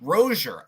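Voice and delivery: Rozier repeated as "ROH-zher"; copied name pronunciation. Retold or imitated. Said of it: "it's actually pronounced Rozier".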